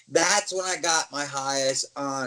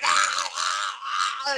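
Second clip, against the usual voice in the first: long, drawn-out mock roars; imitated roar; 6 semitones lower, much slower than usual. Wrestler answering the boys' chant, mock roaring. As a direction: roar it out in slow bursts. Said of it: "I stood straight out and went".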